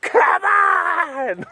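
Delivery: very high, drawn-out hammed-up shout; mock exasperated yell. Driving his struggling laptop with a riding crop, mock yell. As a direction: yell it like a cartoon slave driver. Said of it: "sitting there with a riding crop".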